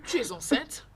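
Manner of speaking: shocked. Acted. Friend learns of the pregnancy, shocked. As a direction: blurt it out in disbelief.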